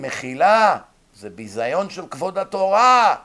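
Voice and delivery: loud, high mock rebuke of a rabbi; hammed-up pious indignation; mock indignant rebuke. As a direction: scold the driver for insulting the Torah's honour.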